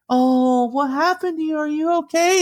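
high-pitched imitation of a concerned girl; cooing concern. Older girl crush comforting crying boy, cooing concern. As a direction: coo over a hurt little kid.

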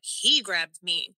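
usual speaking voice

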